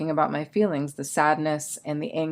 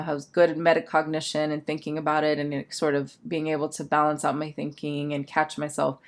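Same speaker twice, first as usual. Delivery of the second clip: usual speaking voice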